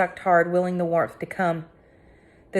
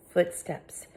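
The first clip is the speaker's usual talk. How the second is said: usual speaking voice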